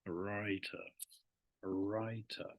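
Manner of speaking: softly spoken WRITER with a clear British T; audible T. Shown as a demonstration. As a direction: demonstrate the crisp T in "writer". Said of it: "You can hear the T".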